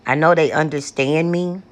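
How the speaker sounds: normal talking voice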